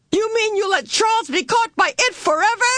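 very high, shrill disbelief. A narrator's reading. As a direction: let the voice rise shrilly in outrage. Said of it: "Meg's voice rose shrilly".

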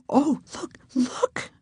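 high, soft, thrilled whisper; excited whisper. A narrator's reading. Susan spotting another winged cat, soft excited whisper. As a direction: gasp it out in delight. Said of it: "Susan whispered".

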